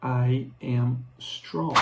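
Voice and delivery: slow, clear model sentences; slow repeat-after-me. Shown as a demonstration. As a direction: say each one slowly for students to copy. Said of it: "repitam comigo". (devagar, para os alunos repetirem)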